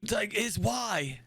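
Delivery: put-on high, insistent old admiral; crotchety old commander explaining. Plays old admiral character.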